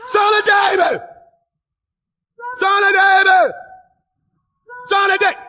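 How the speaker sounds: loud, high full-voiced shout; desperate calling out. Blind man calling Jesus over the crowd, shouted.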